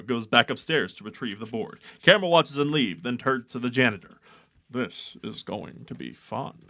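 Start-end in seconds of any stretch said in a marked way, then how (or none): 4.7-6.6 sinister low voice. Acted. Janitor's ominous line.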